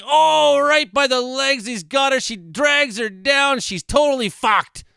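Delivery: live gasping reaction to an attack clip; excited outburst. Real, not acted. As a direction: cry out as the eagle strikes. Eagle attacks woman in video.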